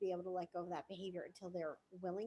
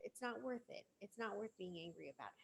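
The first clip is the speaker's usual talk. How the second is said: reassuring partner; 8 dB quieter than usual. Pretend. Calming an angry partner, soft reassurance. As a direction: coax them out of it gently. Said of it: "just say".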